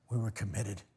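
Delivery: quiet echo. Acted. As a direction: let it fall away.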